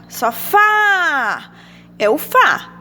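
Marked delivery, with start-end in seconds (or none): 0.1-1.5 Sofá with a long, high "fá"; stress on FA in Sofá. Shown as a demonstration. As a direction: stretch the last syllable of Sofá. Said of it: "Qual a sílaba tônica?". (Sofá com o "fá" forte e esticado)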